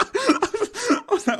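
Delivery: high burst of laughter, slowed words; laughing. Real, not acted. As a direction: crack up mid-line. Laughing at absurd reply.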